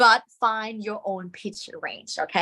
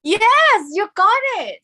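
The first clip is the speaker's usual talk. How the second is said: high, loud burst of approval; delighted praise; 11 semitones higher, 6 dB louder, slower than usual. Real, not acted. Teacher praising students who succeeded, delighted high shout. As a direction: cheer them for getting it.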